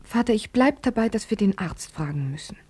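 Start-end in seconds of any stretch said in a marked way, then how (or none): 1.1-2.6 whisper. Acted. Whispered aside.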